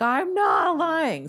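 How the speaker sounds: high, slow, loud yell; furious slow shout of denial; shouted insistence. Retold or imitated. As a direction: slam each word out in protest. Yelling at nurses, threw tray.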